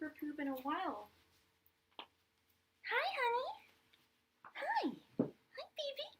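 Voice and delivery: squeaky high pet voice. Real, not acted. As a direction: gush over the puppy coming in. Greeting dog in baby talk.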